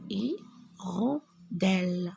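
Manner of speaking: Hirondelle said as i-ron-delle, the H silent. Shown as a demonstration. Teacher to class, silent H in Hirondelle, slow and clear. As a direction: drop the H, start Hirondelle on a clear i. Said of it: "le H ne se prononce pas".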